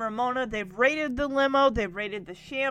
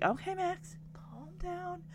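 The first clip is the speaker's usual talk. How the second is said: softer, higher soothing talk; calming big brother; 4 semitones higher, 10 dB quieter than usual. Retold or imitated. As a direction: talk him down gently. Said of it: "Jackson gets over and he's just like".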